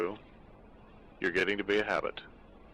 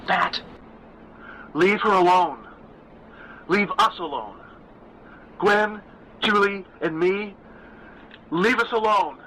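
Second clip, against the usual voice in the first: firm, high, forceful stage whisper; loud stage whisper; 9 semitones higher, 4 dB louder than usual. A narrator's reading. Mother ordering the ghost to leave, in a loud stage whisper. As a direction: project the whisper to the back of a theater. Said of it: "Stephanie whispered firmly, a loud, clear stage whisper".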